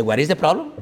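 lightly imitated Indian accent, questioning lilt; imitated Indian English. Indian brother answering a traveller's complaint, lightly imitated Indian accent. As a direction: mimic an Indian man's English, rising at the end. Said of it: "cuando yo estaba en la India".

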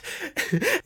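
high giggle; delighted giggle. A narrator's reading. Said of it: "giggling with delight".

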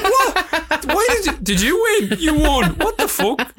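shocked disbelief. Retold or imitated. Filmmaker just told he won, high incredulous laughing. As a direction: gasp it out as if you can't believe you won. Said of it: "I was like".

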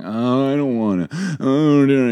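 drawn-out "oh", mock complaining; whining child. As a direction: whine like a reluctant little girl. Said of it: "You cannot whine and complain".

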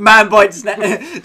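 loud, very slow newsreader headline; Trevor McDonald. Acted. Reading the snake headline as bulletin, mock newsreader voice. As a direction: announce it weightily like a TV newsreader. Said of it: "I imagine Trevor McDonald reading it out like that".

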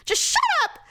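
very high mock yell; exasperated outburst. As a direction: snap in a shrieky retold outburst. Retold exasperated outburst at husband.

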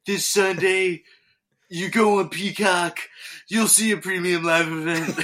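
high, loud hype promo with laughter; wrestling promo. Retold or imitated. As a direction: sell it like a wrestling promo, cracking up. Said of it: "Instead, it's like".

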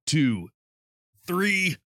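straining through push-ups, voice shaking; counting out reps while straining, breathless; loud unsteady voice. Real, not acted. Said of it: "Can you hear my voice shaking?".

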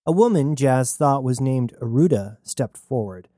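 usual speaking voice

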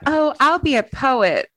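put-on high, wistful teen voice; dreamy high voice. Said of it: "I thought".

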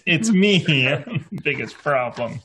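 loud, high, laughing realization. Real, not acted. Player jokes she is the town's problem, laughing. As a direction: burst out laughing through the words. Player cracks up, joking it's her.

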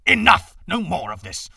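loud, furious command; furious outburst. A narrator's reading. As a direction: shout her down. Father cuts off his wife.

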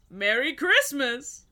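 dragon voice. Retold or imitated.

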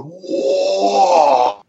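long, high "whoa" of a crowd; imitated crowd roar. Fans watching the kicks land, imitated roar. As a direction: mimic fans wailing in amazement. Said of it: "The fans are all going".

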